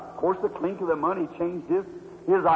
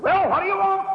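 well jumps high, rude demand; gruff shopkeeper; 10 semitones higher than usual. Retold or imitated. Shopkeeper rudely greeting the woman, gruff. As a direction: snap it at the customer. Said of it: "He said".

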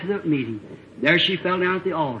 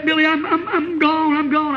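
high, slow crying voice; 10 semitones higher, slower than usual. Retold or imitated. Girl crying to the preacher at the door, high sobbing. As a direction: sob out the words as a frightened girl. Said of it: "she's just crying like that".

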